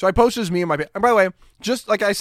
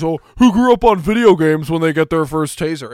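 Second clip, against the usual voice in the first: louder, sing-song mocking echo of "who grew up on video games"; mocking her; 6 dB louder than usual. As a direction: parrot her words to make fun of her.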